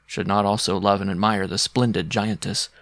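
ordinary delivery

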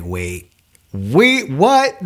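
high, startled double take on what; a retold jolt of surprise, voice jumping up; shocked disbelief. Parents shocked at news.

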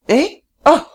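high querying yelp; old man suddenly catching on; startled old man. A narrator's reading. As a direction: pipe up, puzzled then enlightened.

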